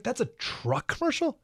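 high-pitched mock disbelief, rising into a question; incredulous reaction. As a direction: act out a baffled viewer's high, questioning reaction. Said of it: "And you're like".

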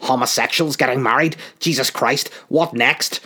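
indignant mock rant, loud and rising; outraged politician. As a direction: lampoon a scandalised politician losing it. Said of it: "they lose their shit".

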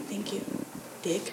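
very quiet, grudging aside; muttered retort. Retold or imitated. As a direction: mutter it as if the cop mustn't hear. Retold aside to police officer.

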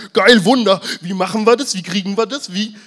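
high, loud, eager mob demanding a miracle; hammed-up crowd yelling for miracles; excited crowd. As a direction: shout it like a greedy crowd.

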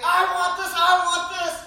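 loud, high mock child demanding; hammed-up toddler tantrum; whining toddler. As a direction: whine it like a three-year-old. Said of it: "A three-year-old in a cafe saying".

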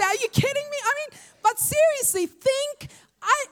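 high, disbelieving yelp of a stunned fiance; mock shocked fiance asking if she's joking; incredulous fiance. Fiance hearing of the angel.

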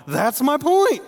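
high, loud told-you-so; smug Saul. Retold or imitated. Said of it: "I'm going".

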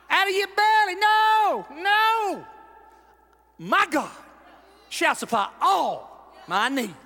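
loud, high shout of faith; defiant preacher shout; shouted declaration. Acted. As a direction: shout it out from the belly.

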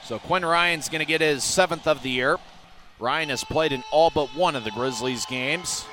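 usual speaking voice

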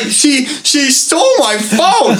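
high, slow, self-pitying whine; whiny drunk complaint. Retold or imitated. Drunk friend whining to buddies that she stole his phone.

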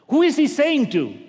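high, loud preaching question; preacher's loud challenge; loud rising question. Real, not acted. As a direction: throw the question out hard.

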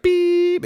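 long, very high, loud beep; imitated monitor beep. Imitating the flatline alarm, a long high beep. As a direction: hold a piercing machine beep.

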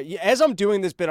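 ordinary delivery

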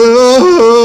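high, loud strangled moan; scared moan. Retold or imitated. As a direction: moan in real terror. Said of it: "I'm like".

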